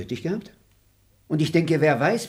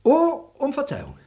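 high, sugary refusal; high fake-polite oh; mock politeness; 11 semitones higher than usual. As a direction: pretend to apologise, mocking.

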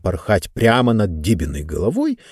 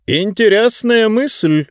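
perking up; 10 semitones higher, slower than usual. Acted. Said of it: "оживился мистер Спикер".

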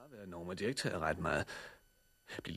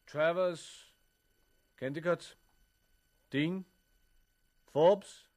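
calling out names; 8 semitones higher, much slower than usual. Acted. Roll call, calling out names.

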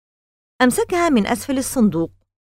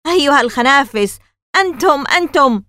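high, loud sputtering rage; the furious old man screams at the boys who broke his swan; 6 semitones higher, 6 dB louder than usual. A narrator's reading. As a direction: scream at the fleeing boys, choking with anger. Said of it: "وهو يصرخ".

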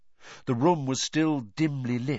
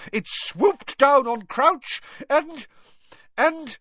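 high, loud, slowed and faltering; McGonagall screaming; 5 dB louder, slower than usual. A narrator's reading. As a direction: cry it out, struggling to find the words. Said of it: "she screamed".

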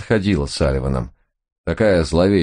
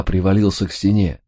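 ordinary delivery

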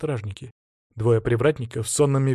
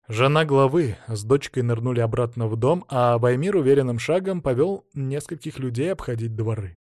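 plain reading voice

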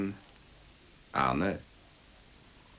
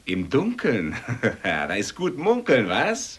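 teasing; 6 semitones higher, 6 dB louder than usual. Acted. Loud teasing jeer.

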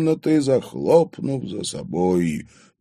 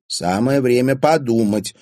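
mildly indignant, raised voice; 5 semitones higher, 5 dB louder than usual. A narrator's reading. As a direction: chide a friend, higher and louder. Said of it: "возмутилась". (с лёгким возмущением)

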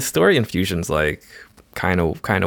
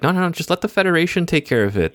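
smug computer; 11 semitones higher than usual. Retold or imitated. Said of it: "the computer is all like".

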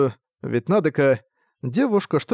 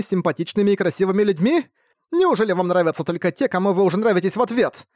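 put-on higher, heated girl scolding everyone; fierce schoolgirl lecturing class; 5 semitones higher than usual. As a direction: rail at the class like an outraged class rep.

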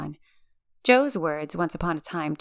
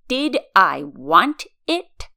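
very slow, loud, deliberate echo; slow and menacing; 6 dB louder, much slower than usual. A narrator's reading. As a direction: drag each word out threateningly. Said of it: "slow and pause".

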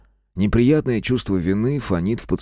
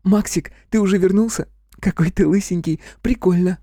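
put-on high girlish chirp; high-pitched, joyful and cute; happy chirping; 11 semitones higher than usual. A narrator's reading. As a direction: chatter like a delighted little sister. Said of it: "Радостно и немного смущённо зачирикала".

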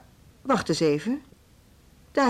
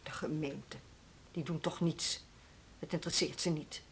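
low, soft, dismissive muttering; resigned scorn; 5 semitones lower, 15 dB quieter than usual. Acted. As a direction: echo it dismissively, voice dropped low. Director dismisses hope of council help.

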